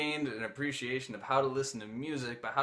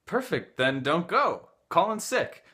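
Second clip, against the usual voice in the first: high, loud eager pitch; pushing him to skip work, thrilled; mock-eager hippie; excited urging; 6 semitones higher, 6 dB louder, slower than usual.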